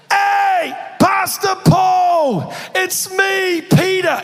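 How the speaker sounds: imitated full-volume yell, high and drawn out; Peter. Peter spots his pastor across the cafe, hollering. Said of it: "he would yell, he had no volume control".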